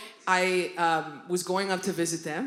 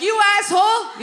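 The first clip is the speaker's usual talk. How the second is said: high, loud retold shout; angry insult; 11 semitones higher, 7 dB louder than usual. Driver shouting after a car speeding away, acted angry shout. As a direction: hurl it after him.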